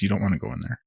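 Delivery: low, quiet and serious warning; large man warning. Retold or imitated. Imitating the large man outside the bar, a low quiet warning. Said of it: "he goes".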